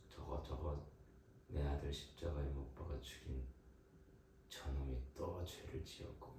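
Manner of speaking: low, quiet, sour muttering about the ungrateful sinner; a begrudging father complaining under his breath; grumbling resentful God. Acted. Preacher voicing God grumbling at a repeat sinner, low sour muttering.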